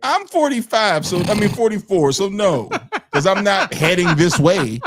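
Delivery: laughing, loud and high. Real, not acted. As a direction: laugh through the denial. Laughing denial about age.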